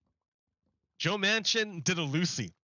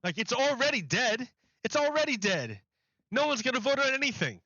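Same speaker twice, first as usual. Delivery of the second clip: exasperated rant; 7 semitones higher than usual. Real, not acted. Ranting about Biden's late tax.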